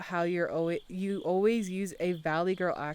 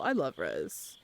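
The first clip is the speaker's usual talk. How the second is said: ordinary talk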